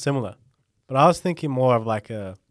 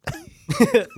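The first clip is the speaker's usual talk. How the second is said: high squeal laugh; 3 dB louder than usual. Real, not acted. Laughing at dad bod joke.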